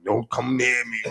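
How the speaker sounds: slow, low mock standoffishness; hard, uncomfortable. Imagining uncomfortable Chief at the party, hard and low. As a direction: imitate someone warning people off, slowly.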